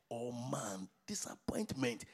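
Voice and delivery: sudden hushed whisper; whispered aside to the congregation. Acted. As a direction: drop to a breathy whisper for effect. Preacher drops to whisper.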